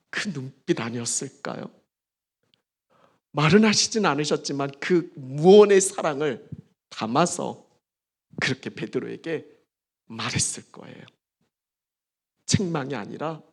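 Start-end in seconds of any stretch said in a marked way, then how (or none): none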